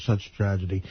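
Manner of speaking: low, solemn German guide; brief dutiful lament in a German accent. Retold or imitated. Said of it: "he has that accent".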